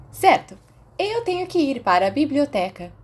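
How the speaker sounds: bright and high, a happy chirp; cheerful. A narrator's reading. Mary cheerfully leaving for the library, high and bright. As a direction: sing it out gaily on her way. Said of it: "Mary disse alegremente".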